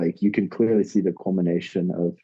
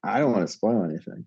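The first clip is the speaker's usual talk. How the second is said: ordinary talk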